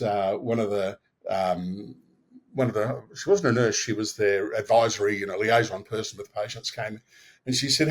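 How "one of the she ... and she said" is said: usual speaking voice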